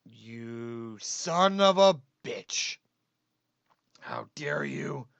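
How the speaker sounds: very low, slow growl; deep growl. Acted. Sudden deep growl at monkey.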